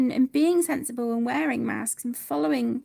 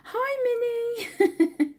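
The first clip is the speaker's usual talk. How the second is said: much higher, bright apologetic hello; corrected greeting; 10 semitones higher, 4 dB louder than usual. Real, not acted. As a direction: fix the name with extra cheer. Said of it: "Not May, Minnie".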